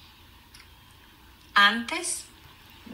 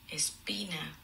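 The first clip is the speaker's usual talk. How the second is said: whispered single word; soft whispered vocabulary word; 2 semitones lower, 5 dB quieter, slower than usual. Shown as a demonstration. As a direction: breathe the word softly.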